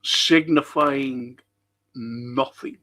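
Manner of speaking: very slow, weighty recital; slow theatrical Shakespeare delivery; dramatic slowing. Shown as a demonstration. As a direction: stretch each word out like a stage actor. Repeating the Macbeth quote.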